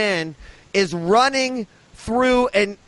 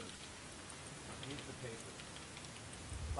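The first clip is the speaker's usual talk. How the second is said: drawn-out whisper, much softer; whispered, slow; 6 semitones lower, 29 dB quieter, much slower than usual. Retold or imitated. Gate agent repeating her request, a slow whisper. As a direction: breathe the words out slowly. Gate lady demanding papers.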